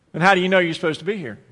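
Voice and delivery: fed-up clerk. Retold or imitated.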